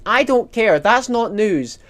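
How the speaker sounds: loud, high, exasperated outburst. Real, not acted. Streamer rants about [name] engagement news, exasperated. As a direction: snap it out, loud and fed up.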